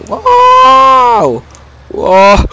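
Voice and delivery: high, loud whooping wow; excited whoop. Real, not acted. Gamer whooping as the tractor slides, excited. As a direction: whoop at the drift.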